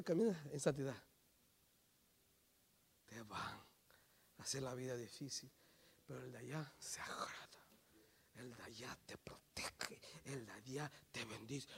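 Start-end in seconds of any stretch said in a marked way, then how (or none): 3.0-11.7 low, very soft near-whisper; hushed preaching. Real, not acted. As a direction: confide it solemnly to the congregation. Hushed promise to the congregation.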